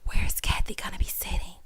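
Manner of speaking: voiceless, sneaky whisper; hushed mimicry of a secret question; imitated whisper. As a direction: whisper it on the side, as if gossiping. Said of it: "she over there whispering to Andy".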